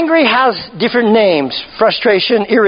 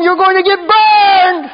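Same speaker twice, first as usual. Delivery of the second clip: slow high warning; 6 semitones higher, slower than usual. Real, not acted. Warning congregation about angry people.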